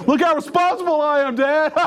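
a hint of a smug kid showing off to dad; mock proud teen.

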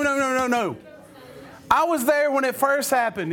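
ordinary talk, nothing marked

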